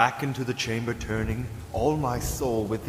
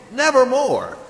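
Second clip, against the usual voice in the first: much higher, louder wail; dramatic cry; 13 semitones higher, 10 dB louder than usual. Acted. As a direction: throw the word out in despair. Climax of the quoted line.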